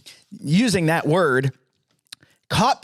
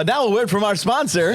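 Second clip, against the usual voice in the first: mock announcer; 4 semitones higher than usual. Mock sponsor break introduced.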